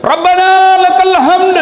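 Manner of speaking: high, slow sung-out response; ringing high prayer chant; muballigh's echo call. Acted. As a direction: relay it to the congregation, high and drawn out. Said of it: "Itu muballagah".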